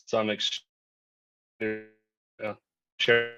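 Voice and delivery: choppy audio cutting out; words dropping out over bad Wi-Fi; bad connection. Real, not acted. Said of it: "your audio is cutting out pretty bad".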